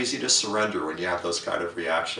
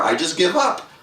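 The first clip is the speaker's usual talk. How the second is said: despairing give-up; 10 semitones higher, 6 dB louder than usual. Retold or imitated. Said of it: "to feel like".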